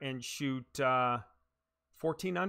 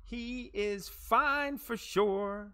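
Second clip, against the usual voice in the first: mock reassurance; 9 semitones higher, much slower than usual. Real, not acted. Teasing reassurance after shot.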